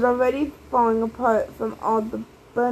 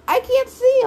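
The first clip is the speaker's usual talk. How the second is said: much higher, louder panicked cry; yelling; 13 semitones higher, 6 dB louder than usual. A narrator's reading. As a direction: cry out blind and frantic. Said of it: "all three sisters yelled".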